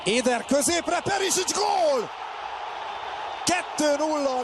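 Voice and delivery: high shouted goal call; excited hungarian sports commentary. Real, not acted. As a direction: build and explode on the goal. Second goal in play-by-play.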